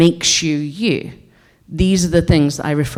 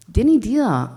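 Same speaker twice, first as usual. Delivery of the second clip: a touch of broad Scots, higher; 6 semitones higher than usual. Shown as a demonstration. Showing her dialect, a little broad Scots. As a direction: drop into broad Scots for a moment. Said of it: "I can speak very broad Scots".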